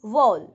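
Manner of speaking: "वाउल" as one gliding syllable, vowl; one-syllable "वाउल", falling; correct pronunciation. Shown as a demonstration. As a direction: say "वाउल" loud, rhyming with owl. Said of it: "कैसे बोलेंगे?".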